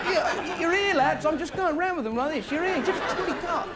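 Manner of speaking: hammed-up joining in with the thieves; mock cheerful thief. The comic joining the thieves who steal his car, hammed-up. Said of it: "it's like".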